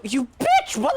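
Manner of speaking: high, loud outburst; angry yell. Real, not acted. Player cursing the boss in a high, loud yell. Boss fight, yelling at enemy.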